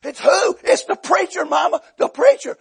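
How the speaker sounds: high-pitched panicked household shouting; mock hollering. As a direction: imitate a family yelling through the house. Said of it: "people hollering at kids".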